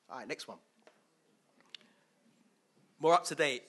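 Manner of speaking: usual speaking voice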